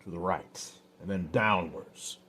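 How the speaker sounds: ordinary delivery, nothing marked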